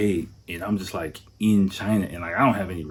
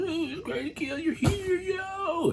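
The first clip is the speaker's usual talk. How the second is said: mock giddy crowd, far higher; hammed-up partygoers greeting the new arrival, much higher; cheery crowd; 5 dB quieter than usual. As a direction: squeal it like a happy party crowd. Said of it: "Everyone's like".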